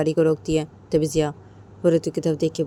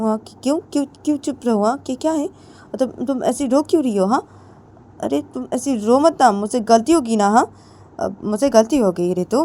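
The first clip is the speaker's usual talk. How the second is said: pleading, pitched up; 5 semitones higher, 5 dB louder than usual. Acted. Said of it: "जिया बोलती".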